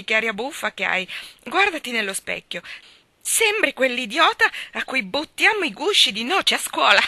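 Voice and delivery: mock her mercilessly, delighted; loud boyish taunting; mocking laughter. A narrator's reading. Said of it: "dal gran ridere".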